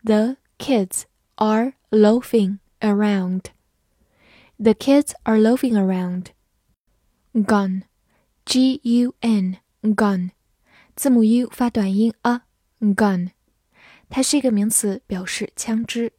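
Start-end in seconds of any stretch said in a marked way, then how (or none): 0.0-3.5 put-on slow, careful read of The kids are loafing around. Shown as a demonstration. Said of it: "好，慢慢来读。". 8.4-13.3 slow spelled G U N, gun; spelling drill. Shown as a demonstration.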